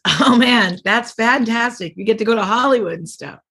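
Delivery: high, eager gushing, slowing on fantastic; a lightly voiced thrilled friend; excited friend. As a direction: gush like a buddy who can't believe your luck. Bobby thrilled about paid regular.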